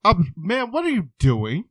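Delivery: high, loud disbelief; shocked. Retold or imitated. Clinic worker stunned by the patient, high and shocked. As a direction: stammer it out, aghast. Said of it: "I'm like".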